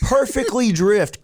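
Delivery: excited brag. Real, not acted. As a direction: savor the drift like a triumph. Bragging about the car drift.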